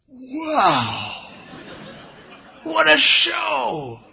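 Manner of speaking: high, drawn-out amazed cry; mock crowd amazement, slow and high; awestruck crowd. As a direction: gasp out hammed-up wonder.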